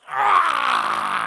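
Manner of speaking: long, loud mock climax cry; hammed-up drawn-out "ah"; climax scream. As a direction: imitate a woman screaming at climax. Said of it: "she climaxes".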